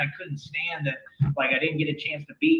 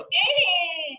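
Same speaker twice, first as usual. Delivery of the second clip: high short grunt on the hit; tennis grunt; 3 dB louder than usual. Retold or imitated. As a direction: grunt like hitting a ball. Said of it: "he would grunt when he hit the ball".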